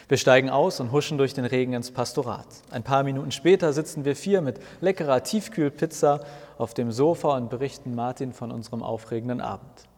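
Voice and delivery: plain reading voice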